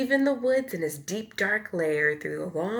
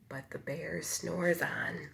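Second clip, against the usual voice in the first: hushed refrain; 3 semitones lower, 8 dB quieter than usual. A narrator's reading. Refrain, bear sleeping.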